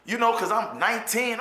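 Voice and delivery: normal talking voice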